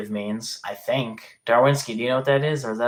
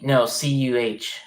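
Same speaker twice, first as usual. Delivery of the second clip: ordinary delivery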